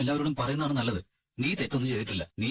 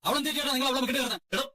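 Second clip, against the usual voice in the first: high, heated accusing protest; angry protest; 9 semitones higher than usual. Acted. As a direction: fire back at the in-laws. Family quarrel, defending Revathi. (ഉയർന്ന സ്വരത്തിൽ ദേഷ്യത്തോടെ)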